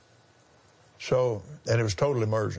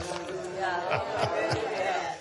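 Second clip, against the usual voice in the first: soft laugh; 7 semitones higher, 5 dB quieter than usual. Real, not acted. Laughter after reading testimony.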